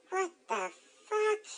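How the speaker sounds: high, stunned mock reaction; acted disbelief. Both walking in on her mom cheating, stunned disbelief. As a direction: pitch it way up, in disbelief.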